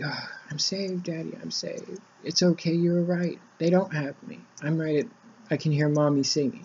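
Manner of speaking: lightly voiced little girl, quiet and relieved; relieved daughter. Daughter relieved after rescue.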